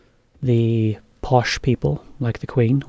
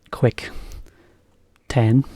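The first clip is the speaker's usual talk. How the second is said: ordinary talk, nothing marked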